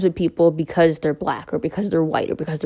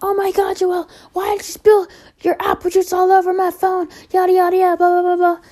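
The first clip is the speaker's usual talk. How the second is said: much higher, whiny mimic of her mother; mocking mom; 13 semitones higher than usual. Girl imitating her mom scolding her over the phone, high mocking voice. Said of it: "she's like".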